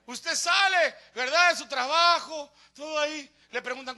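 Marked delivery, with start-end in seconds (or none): none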